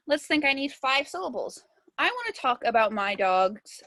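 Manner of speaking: normal talking voice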